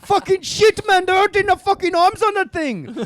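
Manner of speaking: put-on high shouted Indian accent, laughing. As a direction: yell it amazed in an Indian accent. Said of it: "what if an Indian man saw".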